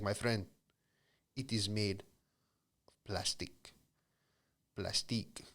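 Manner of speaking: lightly put-on Russian accent of a used car dealer; bad Russian accent. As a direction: salesman pushing the car in a weak Russian accent. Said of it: "It's probably a bad Russian accent".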